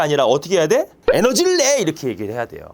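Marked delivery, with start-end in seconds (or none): 1.1-1.8 high, playful encouragement; cheer. Pretend. Teacher giving the scientific cheer to a fallen runner, high. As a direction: cry it out up high, jokingly.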